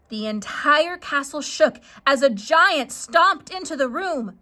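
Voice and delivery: usual speaking voice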